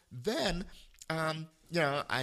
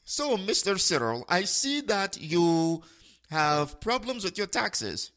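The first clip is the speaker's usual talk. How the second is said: put-on higher, slow Indian-accented scammer voice; imitated Indian accent; 5 semitones higher, 5 dB louder, slower than usual. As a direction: mimic the scam caller's Indian accent, drawn out. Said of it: "duplicate his voice and accent".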